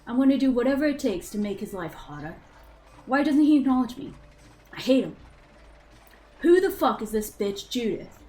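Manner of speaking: put-on a teen boy in a British accent working himself up into fury; angry British accent. Game master reads Zeke's bitter teenage diary entries, angry British accent. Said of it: "we'll try the accent again".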